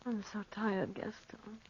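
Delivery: worn-out sigh of words; soft, exhausted murmur; weary. Acted. Exhausted in the desert.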